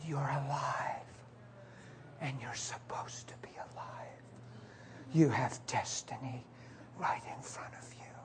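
slow, tender whisper; hushed, unhurried reassurance; sincere. Retold or imitated. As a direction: whisper solemn encouragement to a loved one. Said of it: "I was so sincere".

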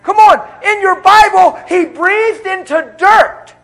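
loud, high, slow preacher roar; preaching at full force. Acted. Preacher roaring to the congregation about God's power, loud and slow. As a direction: thunder it out over the church.

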